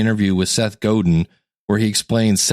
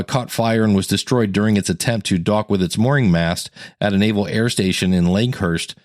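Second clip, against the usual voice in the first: ordinary delivery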